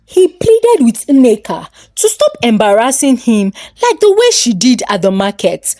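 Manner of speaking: usual speaking voice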